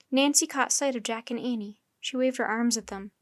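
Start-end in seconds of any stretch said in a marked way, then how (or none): none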